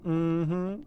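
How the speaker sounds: cheeky rising mm-hmm; sassy mm-hmm. Retold or imitated. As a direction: toss off the mm-hmm with sass. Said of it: "a little cunty with the mm-hmm".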